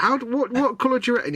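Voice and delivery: high, puzzled voice of a stranger; baffled questioner. Retold or imitated. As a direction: ask it high and confused. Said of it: "somebody will be like".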